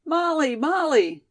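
high, excited call of a name. A narrator's reading. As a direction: call to a friend from upstairs. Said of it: "cried a voice from somewhere".

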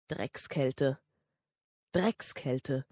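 low, soft muttered curse; quiet cursing. Acted. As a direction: curse quietly under the breath. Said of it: "fluchte er still".

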